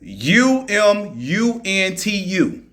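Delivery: U M U N T U spelled slowly, one letter at a time. Shown as a demonstration. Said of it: "I can't even pronounce that shit".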